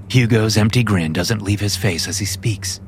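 plain reading voice

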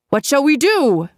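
high, eager question; eager chorus. A narrator's reading. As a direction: ask it all together, keen. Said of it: "chorused the nephews".

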